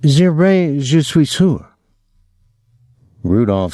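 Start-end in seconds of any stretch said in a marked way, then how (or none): 0.0-1.7 higher, carefully mimicked French teacher; Miss Leno. A narrator's reading. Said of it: "just how Miss Leno would have said it".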